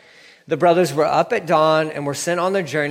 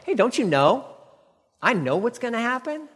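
high teasing sing-song boast; playful knowing jab, pitched high; mock knowing taunt; 10 semitones higher than usual. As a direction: tease them like you hold every card. Joseph taunting his brothers.

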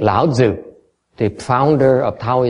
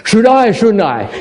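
Should I, much higher and louder, pushing the question; mock inner doubt; 10 semitones higher, 5 dB louder than usual. Teacher acting a student's doubt, higher and louder. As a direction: press the question harder, high and louder. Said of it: "You say".

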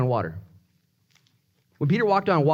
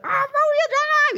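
very high, drawn-out squeal; hammed-up, stretched falsetto cry; mock elated cry; slower than usual. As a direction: act out a high-pitched eureka moment. Acting out his delighted discovery.

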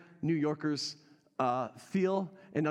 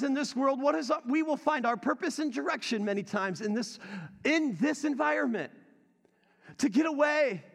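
raised, ringing preacher voice, pitched higher; full-force preaching; 8 semitones higher, 3 dB louder than usual. Acted. Pastor preaching to his church, ringing and raised.

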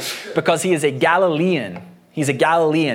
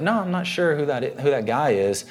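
softer, offhand brush-off; casual denial; 4 dB quieter than usual. Acted. Peter shrugging off a question about Jesus, casual. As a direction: toss it off as a casual, unsure denial. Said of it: "not a simple, casual sort of".

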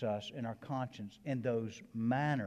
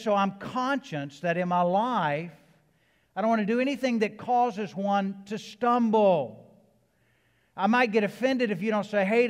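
loud, raised-pitch pulpit delivery; full-force preaching; 7 semitones higher, 9 dB louder than usual. Real, not acted. Preacher warns the congregation about causing one to stumble, loud and forceful. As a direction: drive it out to the whole congregation.

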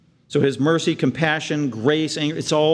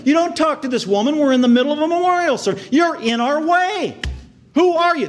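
outraged onlooker; 10 semitones higher than usual. Acted. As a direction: shout like a mourner offended by the rabbi. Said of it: "people there thinking that".

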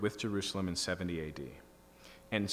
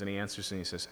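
usual speaking voice

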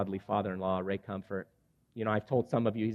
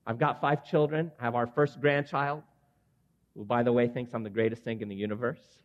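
normal talking voice

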